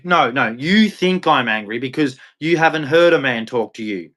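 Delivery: ordinary talk, nothing marked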